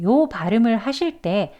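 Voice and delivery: ordinary talk, nothing marked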